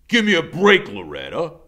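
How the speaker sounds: loud, annoyed; irritated scowl. A narrator's reading. Iggy scowling at Loretta, annoyed. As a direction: brush her off.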